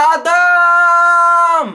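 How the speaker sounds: loud, very high, endlessly held call; mock mom's scream at full stretch; belted teasing high note; teasing friend. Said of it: "they all called me Adam".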